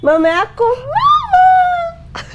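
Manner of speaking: high, loud call for mama; mock calling out. Riders call out for the child's mother, loud high mock call. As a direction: shout out as if searching.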